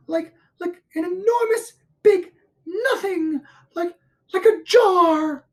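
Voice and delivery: high, squeaky, stammering alarm; breathless, halting, very high character voice; flustered Piglet. A narrator's reading. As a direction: grope for words in a panicky piglet squeal. Piglet stammers out what he saw.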